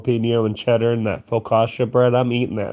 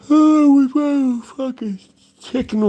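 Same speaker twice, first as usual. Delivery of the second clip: high, loud excited squeal; 3 dB louder than usual. Real, not acted. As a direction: squeal it out with joy. Remembering the chicken excitedly.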